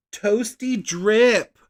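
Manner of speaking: slow, loud, high announcement; excited reveal. Real, not acted. Vlogger reading out a color name, drawn out and thrilled. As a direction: draw out the name with glee.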